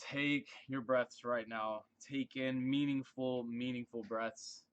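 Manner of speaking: guided meditation. Acted. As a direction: guide them calmly through the breaths. Guides breathing exercise, eyes closed.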